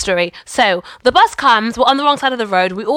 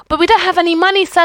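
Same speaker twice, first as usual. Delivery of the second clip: pleading; 7 semitones higher, faster than usual. Retold or imitated. Pleading with the bus driver.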